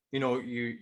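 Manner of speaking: ordinary talk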